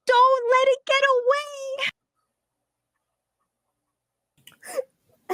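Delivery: put-on high, loud child shouting; little brother hollering after a throw; high-pitched kid yell. GM voices little brother yelling.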